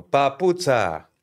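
long drawn-out, loud, high hawker's call; imitated street seller hollering his wares; shoe vendor's loudspeaker cry. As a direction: stretch it out like a peddler on a microphone. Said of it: "Φώναζε από το μικρόφωνο".

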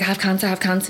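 usual speaking voice

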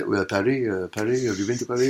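put-on a light French accent as a comic in character; lightly put-on French accent, selling his books; mock French accent. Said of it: "He plays it that he's French".

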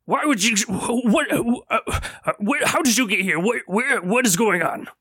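high, fast flustered questions; a hint of a bewildered elder; high flustered old shopkeeper; confused old man.

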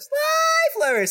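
very high, slow squeal of Sly Flourish; comic high-pitched name call; squeaky high drawn-out. Acted. Running joke on the name.

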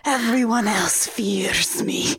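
slow, breathy hammed-up whisper; mock Dracula whisper. Dracula assuring his brides, mock whisper. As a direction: drop into a sinister whisper. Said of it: "and he's like".

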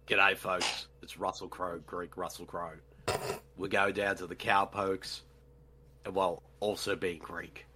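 a low, soft hint of a Southern accent, Russell Crowe as Zeus; Greek Southern Russell Crowe. For co-hosts, a low, soft hint of Southern Russell Crowe accent. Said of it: "your Russell Crowe as Zeus with the Southern accent".

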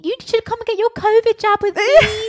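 very high, loud, breathless gush; gushing fangirl. Retold or imitated. Mocking Lou gushing over Tony in the café. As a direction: squeal like a smitten fan.

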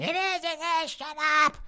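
mock a raging nerd squealing back; mocking angry fanboy. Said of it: "you raging fanboys out there going".

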